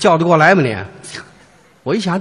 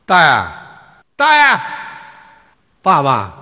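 calling out; 6 semitones lower than usual. Retold or imitated. Calling old man desperately.

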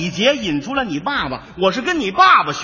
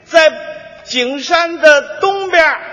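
higher, slow, pausing before 东边儿; lightly voiced old man, deliberate pauses; helpful old Beijing man; 7 semitones higher, 4 dB louder, slower than usual. Old man gives the same landmark yet again, slow with pauses.

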